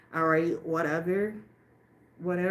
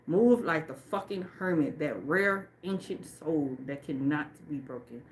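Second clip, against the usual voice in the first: ordinary talk, nothing marked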